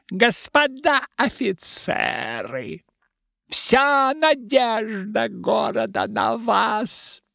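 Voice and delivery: simper it in a thin lisp. A narrator's reading. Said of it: "заговорил противным, тонким и сюсюкающим голосом".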